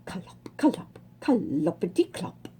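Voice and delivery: soft, low clip-clop of hooves; muted clop clop rhythm; hoofbeat sounds. A narrator's reading. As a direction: tap out the hoofbeats quietly. Hoofs clanking on the road.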